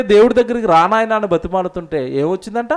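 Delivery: ordinary talk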